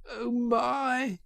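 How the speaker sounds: high, panting wail; gasped dismay. A narrator's reading. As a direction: gasp it out in panic while running. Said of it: "he grasped as he panted along".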